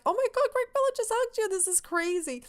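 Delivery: very high, thin fangirl squeal; starstruck squeal. Retold or imitated. Fan after Greg Miller hugged Danny, starstruck squeal. Re-enacting starstruck reaction to hug.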